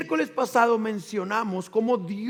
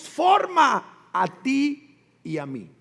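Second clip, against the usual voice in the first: loud, slow, emphatic; preaching shout; 5 semitones higher, 4 dB louder, slower than usual. Real, not acted. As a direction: drive the point home to the church. Preaching climax.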